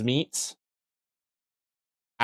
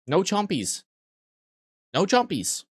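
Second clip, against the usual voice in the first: higher-pitched playful scolding, falling at the end; mock pet scolding; 8 semitones higher than usual. Telling chat to drop it like a pet, mock scolding. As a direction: scold as if to a naughty puppy.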